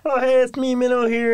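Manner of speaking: high-pitched talking-dog voice; put-on high doggy introduction; Minnow. Shown as a demonstration. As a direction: voice the pet dog in a goofy high register. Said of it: "Give us the Minnow voice".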